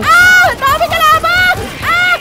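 high, loud cries of alarm; real high-pitched gamer panic; panicked shouting. Real, not acted. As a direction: yell as if swamped by enemies. Overwhelmed by enemies in game.

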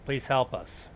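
ordinary talk

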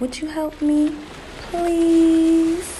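high, wheedling request; wheedling plea. Acted. Spider begging Miss Fowl to count, wheedling. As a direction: draw out a high, begging please.

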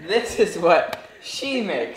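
slow, laughing tease. Real, not acted. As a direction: giggle through the jab. Teasing, laughing.

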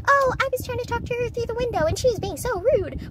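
high, whiny imagined parent complaint; high mock parent whine; complaining parent. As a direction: mimic an offended mom griping. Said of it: "had a parent be like".

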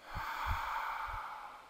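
long, airy exhale; demonstrated releasing sigh; big open-mouthed sigh. Shown as a demonstration. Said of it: "And again".

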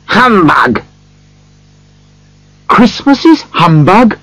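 high-pitched cross outburst. A narrator's reading. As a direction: scoff crossly, pitch jumping up. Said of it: "said Scrooge crossly".

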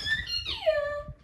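shrieking laugh; high panicked laugh. Real, not acted.

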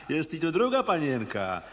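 ordinary talk

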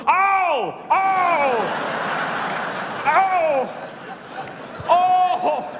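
high, drawn-out pained oh's from a hurt fish; moaning fish. Acted. Hooked fish moaning to the crowd, high and pained. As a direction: moan like a fish just torn off a hook.

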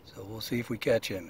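usual speaking voice

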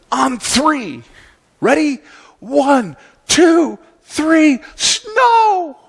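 high-pitched countdown, rising to the last word; a kid commanding the sky in a high voice; little boy Calvin. Acted. Calvin counts down for heaven to send snow, high little-boy command. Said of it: "looks up to the heavens".

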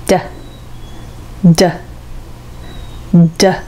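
"D" as a short bare "duh"; isolated, short "D" sounds; D consonant sound. Shown as a demonstration. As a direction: tap the tongue and drop it on each "D". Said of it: "ベロをタッチして".

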